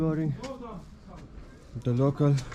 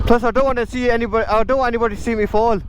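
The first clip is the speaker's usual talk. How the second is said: excited; 10 semitones higher, faster than usual. Real, not acted. Joking about falling.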